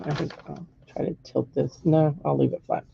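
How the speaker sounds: ordinary delivery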